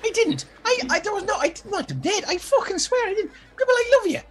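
put-on high, pleading girl, near tears with a laugh; begging Gribble to believe her; high, desperate woman's voice; frantic young woman.

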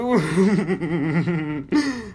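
long, rippling wicked chuckle; drawn-out evil laugh; villainous chuckle. A narrator's reading. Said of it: "Gargamel chuckled".

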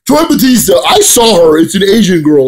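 high, loud mocking voice; imitated reviewer. Mimicking a Smart Buster's claim, high and loud. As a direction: blurt it out as a mock excitable quote. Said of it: "I'm pretty sure he was like".

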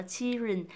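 put-on "children" said like "chi-rin"; faulty children. Shown as a demonstration. Teacher showing the error, "children" without L and D. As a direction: skip the L and D in "children". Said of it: "thì nó lại thiếu hẳn hai âm".

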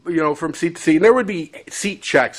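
normal talking voice

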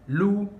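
"Lu" called out high and loud; stressed syllable. Shown as a demonstration. Teacher highlighting the vowel in Lu, stressed. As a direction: punch "Lu" so its vowel U rings out. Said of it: "which is the U".

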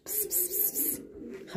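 very soft, voiceless pss-pss; animal-coaxing psst. Real, not acted. Speaker to sleeping animals, soft coaxing pss-pss. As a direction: hiss softly to get the animal's attention.

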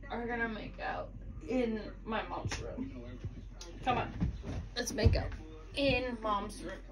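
ordinary talk, nothing marked

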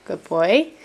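ordinary talk

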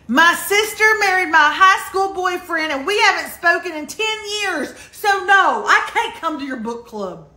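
mock indignant outburst. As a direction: protest it loudly, like an absurd declaration. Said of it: "That's how absurd it sounds".

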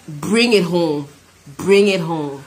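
usual speaking voice

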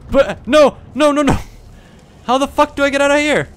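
panicked screaming. Real, not acted. Panicked screaming at game enemy.

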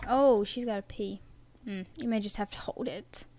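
normal talking voice

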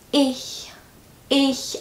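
Ich with an exaggerated breathy German ch; overdone h. Shown as a demonstration. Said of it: "I'm doing the h too much".

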